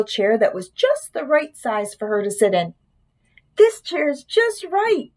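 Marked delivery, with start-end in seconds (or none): 3.5-5.1 high, slow, smiling delight; pleased Goldilocks. A narrator's reading. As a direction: say it slowly with a high, contented smile. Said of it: "she smiled".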